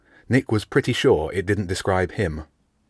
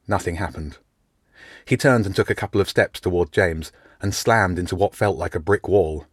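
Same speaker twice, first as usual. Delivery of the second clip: ordinary delivery, nothing marked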